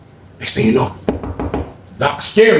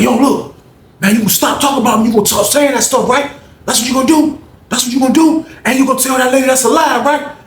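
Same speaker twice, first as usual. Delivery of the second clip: confrontational shout; 9 semitones higher than usual. Retold or imitated. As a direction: call him out aggressively. Confronting him, shouting accusations.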